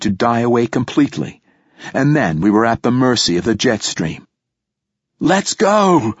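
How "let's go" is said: high-pitched, contained shout; narrator's acted rallying cry; Ania shouting. A narrator's reading. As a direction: call out the order, pitch leaping up. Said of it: "shouted Ania".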